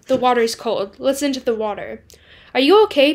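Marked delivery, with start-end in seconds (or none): 2.6-3.1 louder, higher worried call. Acted. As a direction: sister calls out, breaking in. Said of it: "Paige's voice breaks through the chatter".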